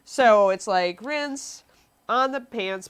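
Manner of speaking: usual speaking voice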